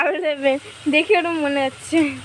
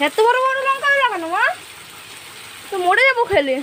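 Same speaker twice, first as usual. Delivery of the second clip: dismayed protest; 8 semitones higher than usual. Real, not acted. Daughter protests big chillies to mother.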